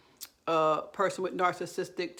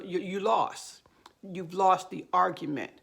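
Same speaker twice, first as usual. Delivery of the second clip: usual speaking voice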